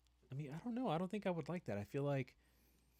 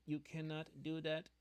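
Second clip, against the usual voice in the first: stiff robot voice, a touch higher; 5 semitones higher than usual. Pretend. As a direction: talk like a strict machine. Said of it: "this technology is like".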